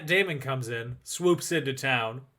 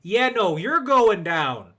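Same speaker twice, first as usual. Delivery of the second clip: louder, slow mock threat; mock tough guy; 5 semitones higher, 6 dB louder, slower than usual. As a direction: ham up a barroom challenge. Mock bar fight threat.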